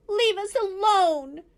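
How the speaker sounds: high, slow, loud squeak; weasel squeak. A narrator's reading. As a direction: squeak it out crouching scared. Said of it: "squeaked Dart".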